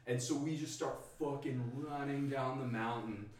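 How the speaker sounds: ordinary talk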